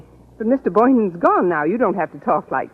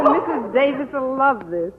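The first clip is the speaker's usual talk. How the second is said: ordinary delivery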